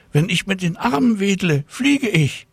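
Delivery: put-on high, thrilled girl voice; girl calling out to her cat that she can fly; excited cry. A narrator's reading. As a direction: announce the discovery breathlessly happy. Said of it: "rief Tina Luise".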